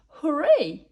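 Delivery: bright cheer of joy; happy exclaim. A narrator's reading. Little witch passing her exams, cheering. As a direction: shout hooray with glee. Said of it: "she exclaimed".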